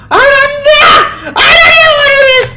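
shrieking very high and loud; freaking out. Real, not acted. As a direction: scream in total panic, voice near crying. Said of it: "I'm freaking out right now".

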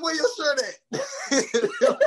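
very high, slow, shouted through laughter; laughing alarmed question. Retold or imitated. Chris Tucker asking where his shirt is, laughing shout. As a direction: squeal it while cracking up.

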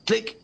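high spoken click noise; mock click sound. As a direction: say the click like a switch. Fakes the TV switching off. (gesprochenes Klickgeräusch)

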